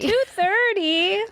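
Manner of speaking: high, slow disbelieving question; shocked. Real, not acted. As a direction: react in mock horror. Shocked at late night.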